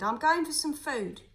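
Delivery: loud, curt, walking off; gruff announcement. A narrator's reading. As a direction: tell him loudly as you leave. Nick ordering Jim.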